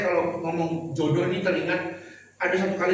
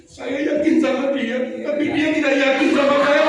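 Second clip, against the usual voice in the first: mild lament; 8 semitones higher, 7 dB louder than usual. Retold or imitated. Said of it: "Iya, Pak".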